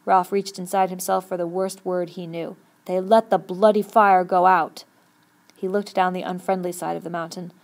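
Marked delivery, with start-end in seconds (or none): 2.9-4.8 slow, loud, heavy anger; forceful, drawn-out swearing; bitter cursing. A narrator's reading. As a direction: hammer each word out in controlled fury. Furious curse at the dead fire.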